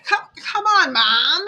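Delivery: high, loud mock whine at Mom; exasperated protest. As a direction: plead loudly with a pushy parent. Acted protest to the mother.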